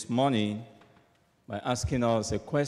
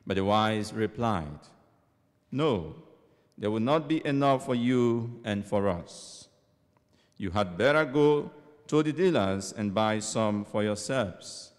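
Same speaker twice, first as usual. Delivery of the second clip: solemn proclaimed scripture; gospel reading. Acted. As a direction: proclaim it like a church lector.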